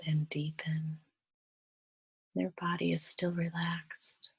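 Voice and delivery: guided meditation. Real, not acted.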